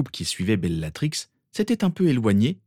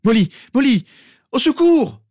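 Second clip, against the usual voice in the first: much higher, louder desperate cries; panicked shouting; 9 dB louder than usual. A narrator's reading. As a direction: scream for help over her body. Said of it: "Arthur Weasley se mit à crier".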